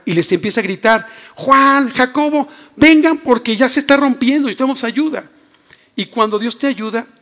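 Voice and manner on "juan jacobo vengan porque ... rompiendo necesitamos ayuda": calling out. Acted. Peter shouts to other boat.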